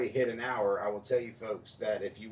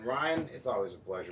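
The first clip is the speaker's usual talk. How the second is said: ordinary talk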